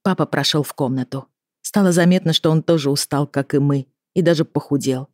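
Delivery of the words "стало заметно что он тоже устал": plain reading voice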